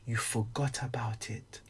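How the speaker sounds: slow hushed whisper; slow secretive whisper for effect. Shown as a demonstration. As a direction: lean in and whisper it slowly. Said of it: "now watch how deep this is".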